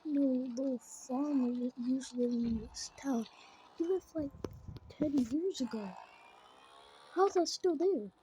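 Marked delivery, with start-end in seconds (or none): none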